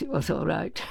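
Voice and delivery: ordinary delivery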